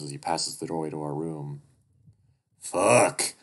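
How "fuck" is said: loud swear, pitch falling; angry curse. Acted. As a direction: spit out the curse. Duke curses, searching the house.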